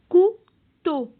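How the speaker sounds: "Couteau" split cou-teau, first syllable high and loud. Shown as a demonstration. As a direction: sound out "Couteau" with a gap between syllables. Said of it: "combien de syllabes". (« Couteau » en deux syllabes séparées)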